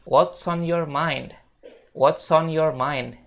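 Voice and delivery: "What's on your mind" slow, every word joined; slow linked sentence. Shown as a demonstration. Teacher models the full linked question slowly. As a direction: link it all, slowly. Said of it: "bisa ngomong kayak gini".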